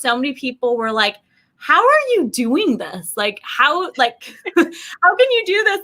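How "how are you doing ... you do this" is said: high, slow disbelieving question; mock bewildered how can you; incredulous. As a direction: ask it like you can't believe it. Retold incredulous question from others.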